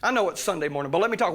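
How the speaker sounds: ordinary talk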